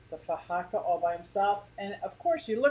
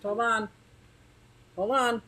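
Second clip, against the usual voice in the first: ordinary delivery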